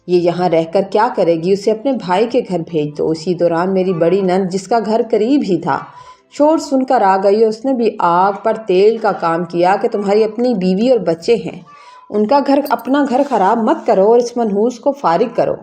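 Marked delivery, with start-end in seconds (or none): none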